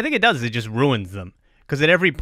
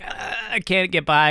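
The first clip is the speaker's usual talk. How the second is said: lightly voiced frail old man, a touch higher; 5 semitones higher than usual. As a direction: imitate an old guy grumbling he can't get by. Old man with walker complains.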